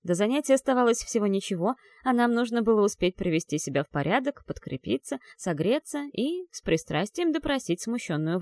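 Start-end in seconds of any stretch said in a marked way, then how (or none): none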